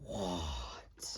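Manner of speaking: low, hushed mock-stunned whisper; whispered shock. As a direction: whisper it in drawn-out disbelief. Retold shock at finding out.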